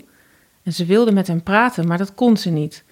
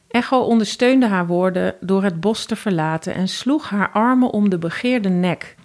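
ordinary delivery

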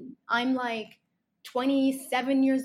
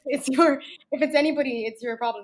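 louder, emphatic repeat; 3 semitones higher, 8 dB louder than usual. Real, not acted. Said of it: "repeating her point louder".

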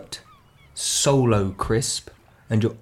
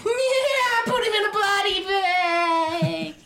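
very high, drawn-out squealed jeer; sandy-haired kid impression; much slower than usual. Sandy-haired crony cheering on the beating, high squealed jeer. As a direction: stretch it into a shrill, slow taunt. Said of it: "little sandy-haired kid".

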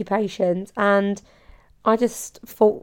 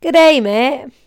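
loud, high, hammy Australian accent; badly faked Australian, pitched up; attempted Australian accent; 5 semitones higher, 7 dB louder than usual. Shown as a demonstration. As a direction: greet loudly in a bad Australian accent. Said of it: "What's an Australian accent?".

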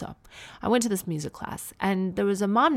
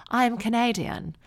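higher, imitated posh transatlantic twang; posh American, British twang; 6 semitones higher than usual. The mom corrects her, posh British-tinged twang. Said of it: "She sort of talked like this".

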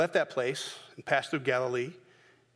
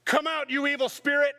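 high, loud commanding cry; mock exorcism; 11 semitones higher, 4 dB louder than usual. Disciple hamming up casting out the spirit loudly.

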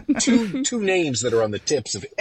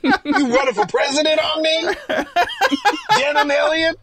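very high, hammed-up indignant character voice; Mother Nature; 3 dB louder than usual. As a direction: scold one man in a piercing put-on voice. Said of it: "She looks at one guy and goes".

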